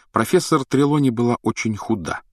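usual speaking voice

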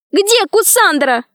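put-on high, drawn-out girl's cry; high-pitched pleading demand; cried out. A narrator's reading. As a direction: call out in a high, urgent voice. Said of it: "закричала Алиса".

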